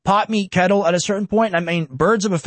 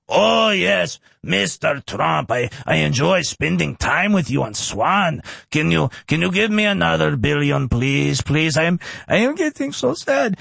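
Zelensky impression; 3 semitones lower than usual. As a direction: whine for more money in a put-on Ukrainian accent. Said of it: "losing my Zelensky impression".

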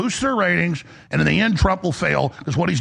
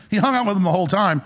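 ordinary delivery

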